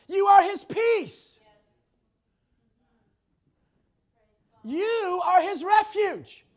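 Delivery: loud, slow proclaiming, stress on every "are"; full-force preaching. Acted. Preacher declaring to the church, loud and emphatic. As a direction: build it up line by line like a preacher.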